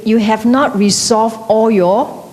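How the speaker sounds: usual speaking voice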